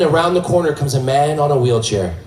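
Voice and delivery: usual speaking voice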